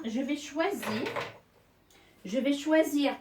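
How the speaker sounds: ordinary talk, nothing marked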